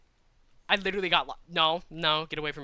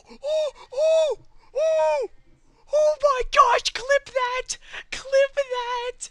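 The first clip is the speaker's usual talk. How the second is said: shrieking very high and loud in disbelief; screaming clip that; 12 dB louder, much slower than usual. Real, not acted. Streamer screams at chat to clip the moment, high frantic shriek. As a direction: scream it like you can't believe your eyes.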